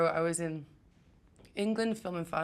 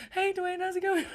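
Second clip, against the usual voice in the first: much higher, flustered fan voice; starstruck nervous greeting; 13 semitones higher than usual. Retold or imitated. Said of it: "stuttering when I speak to him".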